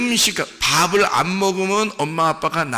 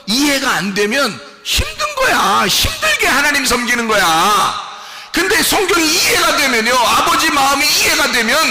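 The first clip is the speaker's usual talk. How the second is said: high, loud preaching shout; full-force preaching; 9 semitones higher, 5 dB louder than usual. Real, not acted. Preaching climax.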